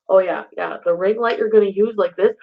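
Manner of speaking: ordinary talk, nothing marked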